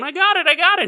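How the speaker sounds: very high excited yelp; doctor running through the hospital, thrilled; giddy high cry. Acted. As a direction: squeal like a kid with the golden ticket.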